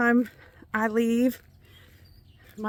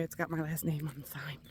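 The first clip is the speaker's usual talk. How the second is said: whispered aside, softer; secretive whisper; 6 semitones lower, 11 dB quieter than usual. Real, not acted. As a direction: drop to a whisper to keep it private. Hiding her last name.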